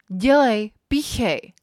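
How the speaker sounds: high, very loud urgent command; shouted order. A narrator's reading. Repeated urgent command in story.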